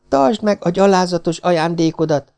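high, indignant cry with mild force; shouted. A narrator's reading. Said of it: "kiáltotta".

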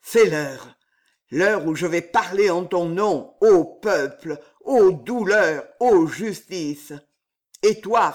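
tragic stage declamation; french classical theatre. A narrator's reading. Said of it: "d'une voix tragique murmura".

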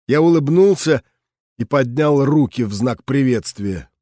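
ordinary delivery

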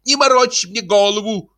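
high, annoyed female voice; exasperated high-pitched woman's cry; woman calling out. Acted. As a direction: scold the husband from the next room. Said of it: "закричал женский голос из комнаты".

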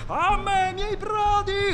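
high, slow rallying call to his men; pirate's yell. A narrator's reading. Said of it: "urlò il pirata".

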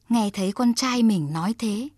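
usual speaking voice

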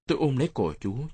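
plain reading voice

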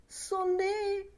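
high, excited call; urging call. Acted. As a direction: cry out for the chime. Lenehan urging the bell.

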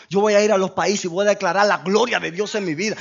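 ordinary delivery

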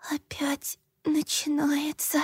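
breathy pained whisper. A narrator's reading. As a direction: whisper it in pain. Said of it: "и прошептала".

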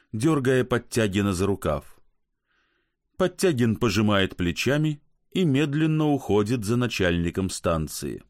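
ordinary delivery

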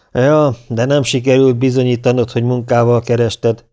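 usual speaking voice

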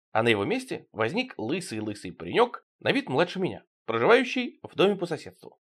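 ordinary delivery

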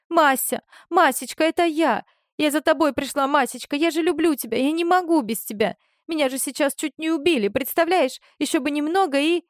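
high, breathless pleading to her lover; high-pitched desperate plea. Acted. Desperate Rita pleads with Oleg.

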